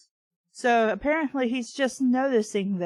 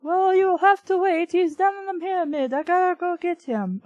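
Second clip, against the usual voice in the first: put-on much higher and louder, a shouted Egyptian accent; bad Egyptian accent; 7 semitones higher, 6 dB louder than usual. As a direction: play a loud, hammed-up Egyptian phone worker. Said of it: "my really shitty Egyptian, not exactly accent".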